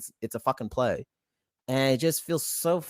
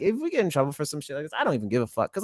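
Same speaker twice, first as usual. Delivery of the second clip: ordinary talk, nothing marked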